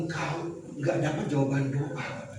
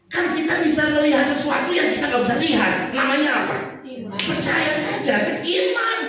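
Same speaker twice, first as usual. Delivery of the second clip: high, loud preaching voice, "iman" lifted high; preaching at full force; 9 semitones higher, 4 dB louder than usual. Acted. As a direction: press home the call to faith. (khotbah berapi-api, "iman" ditarik tinggi)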